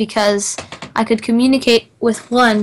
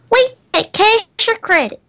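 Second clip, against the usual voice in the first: mock cashier; 10 semitones higher than usual. Joking pledge payment question.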